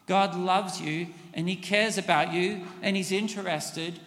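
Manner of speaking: ordinary delivery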